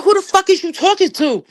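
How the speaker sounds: a touch of outraged yell; angry retort. Retold or imitated. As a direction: fire back like you're offended. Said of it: "I said".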